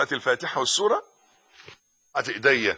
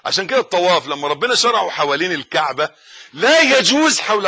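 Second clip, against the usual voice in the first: forceful preaching; 5 semitones higher, 7 dB louder than usual. Acted. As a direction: proclaim each phrase to the congregation.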